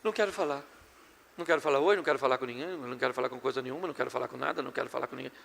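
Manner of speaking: low, soft, sulky mumble, trailing away; sulky spouse refusing to talk to their partner, mumbled low; sulky refusal. Acted. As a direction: keep pushing the other away in a low mumble.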